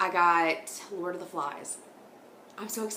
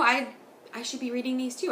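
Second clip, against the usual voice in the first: usual speaking voice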